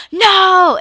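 high, loud cry of dismay; retold desperate shout of no; retold anguished shout. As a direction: yell it as the jar flies out. Ex sees his weed thrown.

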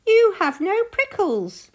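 high, loud rabbit chorus voice; high-pitched animal taunt; jeering rabbits. A narrator's reading. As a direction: call it out in a squealing, teasing voice. Rabbits jeer in story.

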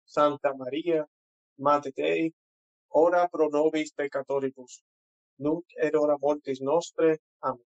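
devout recited response in Latin; Latin prayer. Real, not acted. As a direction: answer the prayer, steady and reverent. Answers the Hail Mary.

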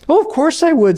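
a nervous ghost making excuses; fretful ghost. Acted.

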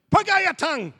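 high, loud barked order; sudden command. Retold or imitated. Wigglesworth orders a stranger on the road, loud high command.

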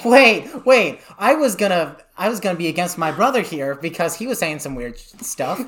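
can barely talk for laughing. Real, not acted. Laughing at brother's absurd claim.

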